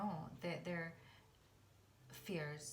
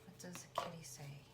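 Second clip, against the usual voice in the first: soft unvoiced whisper; 3 dB quieter than usual. Real, not acted. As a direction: whisper it like a secret. Whispered repeat of question.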